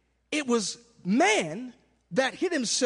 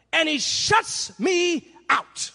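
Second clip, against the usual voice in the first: slow dramatic line; 5 semitones higher, much slower than usual. Acted. Dramatic slow drop after scream.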